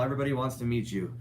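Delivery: ordinary talk, nothing marked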